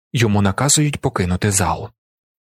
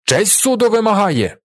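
high, exclaimed courtroom objection; indignant protest; 10 semitones higher than usual. A narrator's reading. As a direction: call out over the uproar. Prosecutor protests in courtroom uproar.

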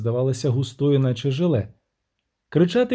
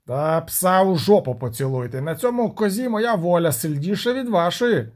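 put-on sailor voice, agitated; defiant captain; 4 semitones higher than usual. Captain refuses to risk his ship, agitated protest. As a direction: argue back as a stubborn sea captain.

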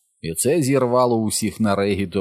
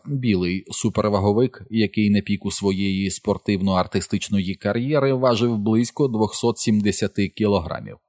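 usual speaking voice

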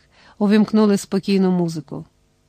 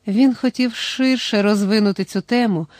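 ordinary delivery, nothing marked

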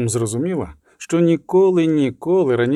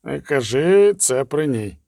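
slow, measured warning; cautioning; slower than usual. A narrator's reading. Vesemir warns him while the girl is near, cautioning. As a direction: caution him slowly and deliberately. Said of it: "застеріг Весемір".

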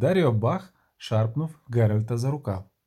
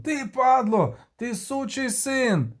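furious shout; 10 semitones higher, slower than usual. Acted. Kobin curses the deserter rowing away, high furious yelling. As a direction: hurl the insults after him. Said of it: "крикнув Кобін".

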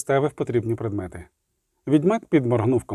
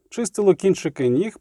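usual speaking voice

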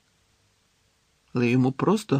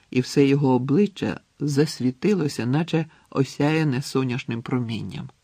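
plain reading voice

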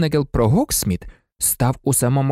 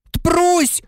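roaring Ron. A narrator's reading. Ron yells as the cat attacks his bag. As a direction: yelp it out as the cat pounces. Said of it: "заревів Рон".

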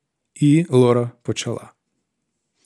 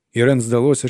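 ordinary delivery

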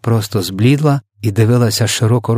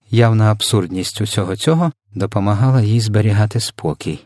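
plain reading voice

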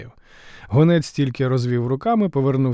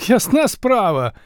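happy wheeze; 9 semitones higher, 4 dB louder, slower than usual. Acted. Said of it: "щасливо хрипів Ліхас".

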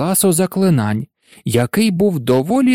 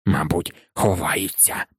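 low, far-off caretaker grumbling; low grumble; 10 semitones lower, 6 dB quieter than usual. A narrator's reading. Caretaker Filch grumbling while searching the trophy room, low and gruff. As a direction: growl it under the breath, much lower. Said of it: "Почули вони його буркіт".

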